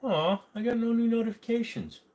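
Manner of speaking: high-pitched little pout; put-on high, sulky voice; mock pouty whine. Mock disappointment, reading his screen.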